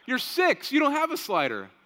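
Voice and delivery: loud, high-pitched incredulous dad; retold exasperated protest; mock disbelief. Dad retells disbelief at son's boast.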